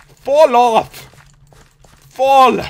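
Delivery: loud, high, forceful urging; exasperated shout. Real, not acted. Gamer to last opponent still standing, exasperated shout. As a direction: shout it at a stubborn rival.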